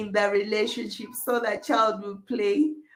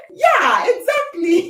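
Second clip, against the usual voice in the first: much higher, louder "exactly"; excited agreement; 9 semitones higher, 8 dB louder, slower than usual. Real, not acted. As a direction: burst out in agreement.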